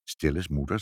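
low, soft hush. Acted. Father hushing mother on hearing a sound, low. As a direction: quietly stop her to listen.

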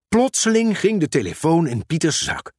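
plain reading voice

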